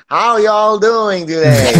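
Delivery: mock Southern US accent, high and drawn out; hammed-up Southern drawl of a campaigning politician, slow and high; Hillary Clinton. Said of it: "she put on a fucking accent".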